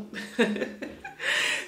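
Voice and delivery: breathy high-pitched giggle; a quick airy giggle. Real, not acted. Laughs about wearing robe daily.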